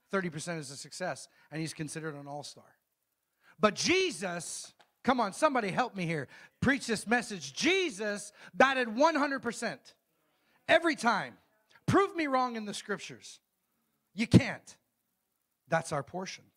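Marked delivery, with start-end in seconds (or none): 3.6-14.8 high, loud preacher at a climax; full-force preaching. Real, not acted. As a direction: drive it home to the congregation, raised and emphatic.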